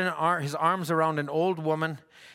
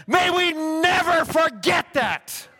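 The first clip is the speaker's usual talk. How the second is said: much higher, louder preacher's shout on never; shouted plea; 12 semitones higher, 5 dB louder, slower than usual. Real, not acted. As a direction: cry it out to the whole church. Climax of the exhortation.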